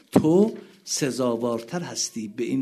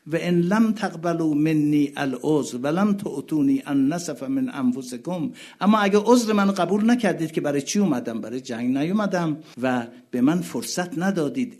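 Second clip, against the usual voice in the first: loud, high pleading sermon voice; impassioned preaching; 4 semitones higher, 5 dB louder than usual. Acted. Preacher voicing Husayn pleading with the army, loud. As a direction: plead with the crowd.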